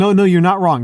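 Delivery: loud, high, emphatic agreement; excited insistence. Real, not acted. Cutting into the argument with an eager, loud insistence.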